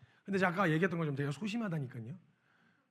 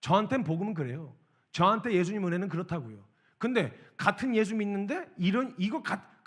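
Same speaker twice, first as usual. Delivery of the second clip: ordinary talk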